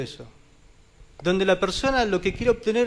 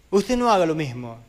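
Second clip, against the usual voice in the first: ordinary delivery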